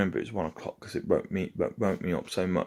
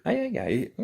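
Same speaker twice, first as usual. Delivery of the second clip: imitated female voice in high falsetto; very high-pitched imitation of a woman; woman calming dog. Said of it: "the woman's voice goes".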